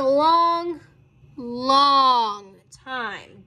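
both "long"s stretched out slowly; drawn-out emphasis. A narrator's reading. Narrator tells of tiring trip, stretching "long, long". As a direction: drag "long, long" out for weight.

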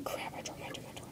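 hushed, breathless whisper under the breath; real whispered aside. Real, not acted. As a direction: mutter it off-mic after dropping a piece.

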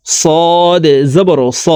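صاد said thick and drawn out; heavy letter. Shown as a demonstration. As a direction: give স a heavy, full s. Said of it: "সদ মোটা করে উচ্চারণ করতে হবে".

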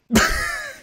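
sharp, very high startled gasp; shocked gasp. Real, not acted. On seeing the dreaded villager, very high shocked gasp. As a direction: gasp in horror at the screen.

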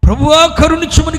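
high, loud cry. Acted. Woman crying to the Lord, loud shout. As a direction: shout the plea. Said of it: "కేకలు వేస్తుంటే".

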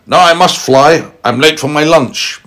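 high, loud wolf making excuses; hurried wolf. Acted. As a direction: pitch the wolf up, eager to be off. Wolf acted, hurrying off to lunch.

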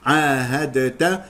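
"عاهدت" said slowly as 'aahadeta, released d; dal pronounced separately. Shown as a demonstration. Teacher showing the mistake, slow "عاهدت" with audible d. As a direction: sound the d in "عاهدت" before the t. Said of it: "هذا خطأ".

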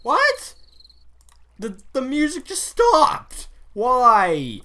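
high, loud indignant whining; indignant complaint. Real, not acted. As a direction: complain like you've been betrayed. Outraged the music stopped.

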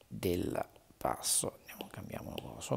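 fast whisper to yourself; whispered aside. Real, not acted. Teacher whispering to herself while switching sections. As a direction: mutter the aside under your breath.

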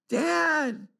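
put-on high, drawn-out child's whine; whiny high call for a parent; little boy's cry voice. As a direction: imitate a tearful kid calling from upstairs. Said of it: "I hear the cry voice from upstairs".

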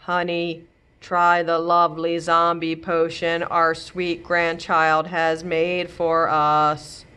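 slow, blank zombie drone. Acted. Grandma offers grandpa the potion in a slow zombie drone. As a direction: drag the words out like the living dead. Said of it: "a very zombie-sounding voice".

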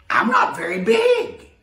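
very high, loud little-engine shout; little blue engine. Acted. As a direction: squeak it out, small but eager. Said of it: "said the Little Blue Engine".

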